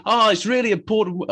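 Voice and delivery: high-pitched mock accent, slipping towards Australian; Kevin Pietersen impression. Cricket pundit mimicking a commentator's wicket verdict, botched high-pitched accent. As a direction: put on a botched, high South African-style accent. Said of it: "what impression that was".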